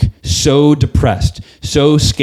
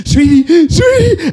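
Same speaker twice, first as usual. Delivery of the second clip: much higher, wailing, tearful "sweetie"; sobbing high voice; 5 dB louder, slower than usual. Retold or imitated. Husband waking his wife at dawn, mock-sobbing way up high. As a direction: squeeze the words out through comic sobs. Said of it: "And I'm bawling my eyes out".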